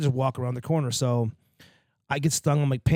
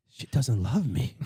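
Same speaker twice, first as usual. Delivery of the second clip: mock hurt; 3 semitones lower, 3 dB quieter, slower than usual. Mock hurt about wife.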